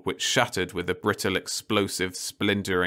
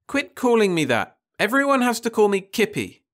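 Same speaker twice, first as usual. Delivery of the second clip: high, loud bratty insistence; petulant girl; 13 semitones higher, 4 dB louder than usual. A narrator's reading. Daughter insisting her mother call her Kippy, high and bratty. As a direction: stamp your foot over the name.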